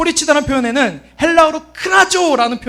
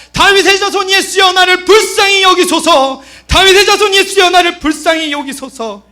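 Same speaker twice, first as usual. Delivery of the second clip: full-force high shouted plea; impassioned, high, loud cry; 8 semitones higher, 7 dB louder than usual. Acted. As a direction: shout with all your might for mercy. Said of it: "예수를 부르짖는 겁니다".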